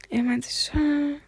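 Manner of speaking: nachgeahmtes, fast tonloses Flüstern; nicht laut aussprechen. Retold or imitated. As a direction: das Wort verschämt weghauchen, viel leiser. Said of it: "das nicht laut aussprechen". (verschämt geflüstert, am Ende nur ein „äh“)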